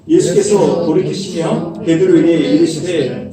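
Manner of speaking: slow, weighty reading of Jesus rebuking; solemn scripture reading. Acted. Preacher reading Jesus rebuking Peter to the congregation, slow and solemn. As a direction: read it out slowly and grandly over music.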